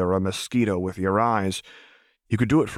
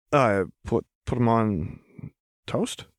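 uncertain; 3 semitones higher than usual. A narrator's reading. Chuck answers uncertainly.

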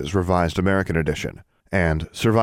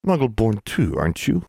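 grumbling; 5 semitones higher than usual. A narrator's reading. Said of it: "he grumbled".

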